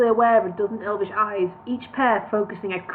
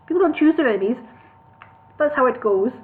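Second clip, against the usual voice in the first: higher Birmingham voice for Bingo; Birmingham accent; 4 semitones higher, 4 dB louder than usual. Acted. Bingo questioning elf lord in Birmingham accent. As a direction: Brummie voice, louder. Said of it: "the wrong accent".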